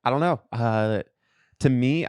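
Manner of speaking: ordinary delivery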